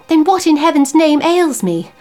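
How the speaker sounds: anguished outcry. Acted. Husband in anguish.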